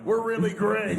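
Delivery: high, loud jokey cheer; mock boast. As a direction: call it out like a playful brag. Jokey boast prompting the guest.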